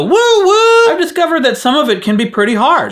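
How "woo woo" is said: very high loud "woo woo" whistle; playful train whistle cry. Pretend. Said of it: "personal wellness train".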